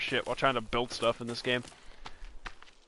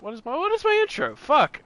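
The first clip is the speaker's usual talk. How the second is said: frustrated; 11 semitones higher than usual. Real, not acted. Can't remember his own intro.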